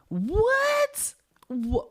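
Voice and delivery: high disbelieving shriek; stunned what. Real, not acted. Teller reacting to the killer's brag, shrieking in disbelief.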